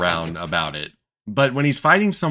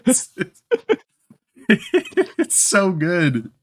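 laughing; 13 semitones higher, 3 dB louder, slower than usual. Real, not acted. Gushing over favorite scene.

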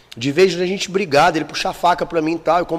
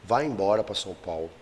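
quiet retold father; 7 semitones lower, 10 dB quieter than usual. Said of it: "o pai dele virou e falou assim".

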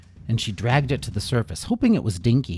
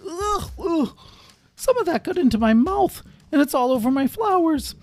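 much higher grossed-out creature voice; Bramble disgusted; 3 dB louder than usual. Acted. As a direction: sputter it out in disgust. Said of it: "And Bramble went".

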